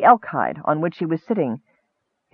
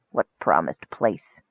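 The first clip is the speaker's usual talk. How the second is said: low, slow, softer sleepy question; drowsy Highbulp; 13 semitones lower, 6 dB quieter, slower than usual. A narrator's reading. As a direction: ask it low and slowly, half asleep. Dozing Highbulp answers the dream voice.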